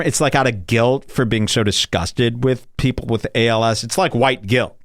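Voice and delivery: ordinary talk, nothing marked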